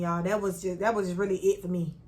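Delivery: ordinary talk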